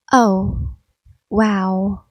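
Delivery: ordinary talk, nothing marked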